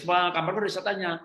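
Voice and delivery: lebih tinggi seperti anak yang mengadu, agak lebih keras; suara anak kecil. Retold or imitated. Ayah menirukan anak yang menangis. (anak kecil mengadu ketakutan)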